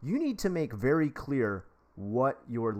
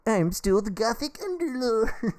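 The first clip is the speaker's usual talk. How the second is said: much higher, slow, mock-dramatic self-parody; hammed-up goth persona; 13 semitones higher, 7 dB louder, slower than usual. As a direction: ham up the gothic underlord. Jokingly claims his old goth persona.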